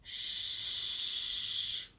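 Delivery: long unvoiced Shh like be quiet; shushing sound. Shown as a demonstration. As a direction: hush them with a soft Shh. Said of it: "like be quiet".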